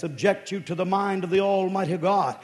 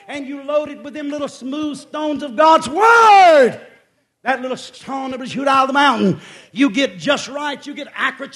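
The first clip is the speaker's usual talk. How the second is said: building sermon; 4 semitones higher, 9 dB louder than usual. Acted. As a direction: drive each phrase home from the pulpit. Preaching builds to full force.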